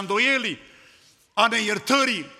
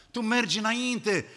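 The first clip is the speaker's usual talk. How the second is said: usual speaking voice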